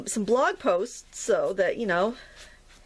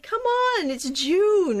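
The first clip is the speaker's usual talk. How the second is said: high, drawn-out plea; exasperated; 9 semitones higher, much slower than usual. Retold or imitated. Artist griping to viewers about cold June weather, exasperated. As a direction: protest the weather in a high, stretched voice.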